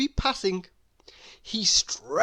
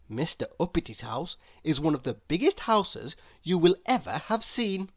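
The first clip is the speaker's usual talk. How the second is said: ordinary delivery, nothing marked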